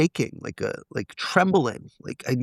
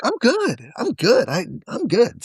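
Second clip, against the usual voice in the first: high-pitched, repeated self-convincing; breezy self-reassurance; 9 semitones higher, slower than usual. Retold or imitated. Convincing himself before telling his wife, breezy self-reassurance. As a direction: reassure yourself in a much higher voice.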